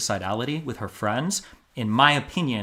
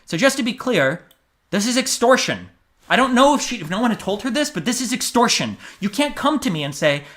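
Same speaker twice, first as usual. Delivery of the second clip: heated indignation; 9 semitones higher, 4 dB louder than usual. Real, not acted. Indignant rant about extortion.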